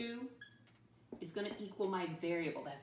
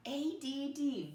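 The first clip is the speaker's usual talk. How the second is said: spelling out letters; 6 semitones higher, much slower than usual. Shown as a demonstration. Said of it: "How do you think you spell add?".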